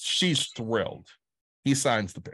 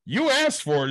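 much higher, louder teasing jab; mocking handler; 11 semitones higher, 5 dB louder than usual. Handler teasing Kevin about the misspelling, high mocking jab. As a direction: needle him like a smug sidekick. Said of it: "the guy looks at him and goes".

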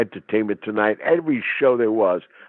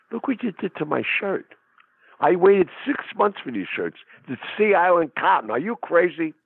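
pitched up, mock-outraged complaint; indignant about the shirt; 6 semitones higher than usual. To the man who cut him, pitched-up indignant protest. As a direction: act wounded over a ruined shirt.

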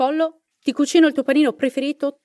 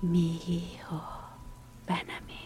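softer, faint ghostly murmur trailing into breath; thin broken voice; 8 semitones lower, 12 dB quieter, much slower than usual. Acted. Llorona calling the boy to her, thin broken ghostly voice. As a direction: let the words break and fade like a reed in a storm. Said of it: "una voce sottile e malconcia".

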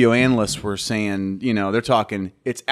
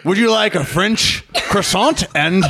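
mock fancy announcer; 2 semitones higher than usual. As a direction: ham up a posh ad read. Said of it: "to be like".

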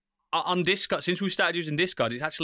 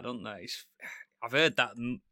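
ordinary talk, nothing marked

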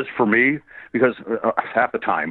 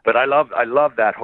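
ordinary talk

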